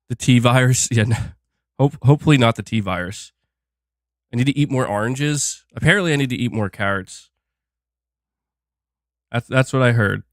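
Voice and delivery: ordinary talk, nothing marked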